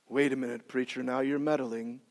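low, soft aside to the preacher; objecting listener. Acted. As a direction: grumble it low and quietly, as from the pews.

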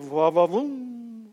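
very slow, drawn-out leer; mock ogling. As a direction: stretch it out like a cartoon admirer. Acts ogling a passing woman.

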